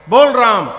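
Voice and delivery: loud barked order; angry command. Acted. Guru shouting at the stubborn son to say Ram, loud angry order. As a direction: force him to say it.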